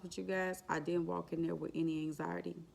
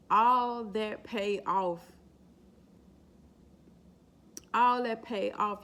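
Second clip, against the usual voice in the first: louder and slow, pressing every word; emphatic; 4 semitones higher, 8 dB louder, slower than usual. Real, not acted. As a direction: hammer home the payoff slowly. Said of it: "emphatic testimony".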